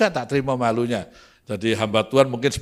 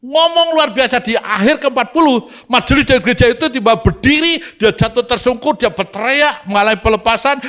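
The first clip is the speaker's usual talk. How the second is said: high, driving revival-preacher delivery; impassioned pulpit storytelling, pitched high; full-force preaching; 13 semitones higher, 9 dB louder than usual. Acted. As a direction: ride the climax of a testimony at full voice.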